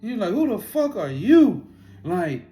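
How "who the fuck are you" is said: loud, slow, high retold challenge; confrontational. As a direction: demand it as Ultron, drawing it out. Retold Ultron confronts the Watcher.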